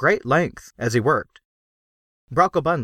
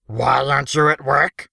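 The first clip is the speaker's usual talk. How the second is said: a hint of a bossy overseer, louder; gruff overseer; 4 semitones higher, 3 dB louder than usual. A narrator's reading. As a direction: demand it like a foreman catching idlers. Overseer scolding the workers.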